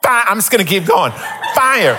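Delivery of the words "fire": mock alarm voice. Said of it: "this voice comes from the house".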